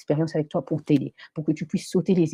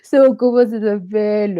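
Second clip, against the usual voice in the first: high, loud voice just out of a laugh; retry the sentence while still giggling; amused, through laughter; 5 semitones higher, 5 dB louder than usual. Real, not acted. Host gropes for a channel's name, amused and laughing.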